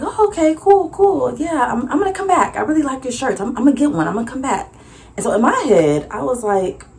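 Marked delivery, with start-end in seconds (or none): 0.0-4.7 customer voice. Retold or imitated.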